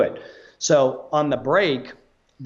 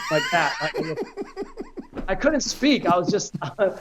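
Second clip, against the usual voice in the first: laughing; 8 semitones higher than usual. Real, not acted. Laughing at his starstruck freeze.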